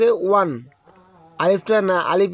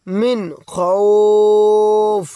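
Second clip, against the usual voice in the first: খৌফ held very long and louder; long drawn madd; 5 dB louder, much slower than usual. Shown as a demonstration. Teacher reciting the verse for the students. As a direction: stretch out খৌফ fully. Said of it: "উচ্চারণ দিয়ে পড়ব".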